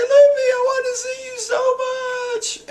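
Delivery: very high, wailing sob-voice; mock crying. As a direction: wail it out, hamming up the tears. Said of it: "poor Brook is crying".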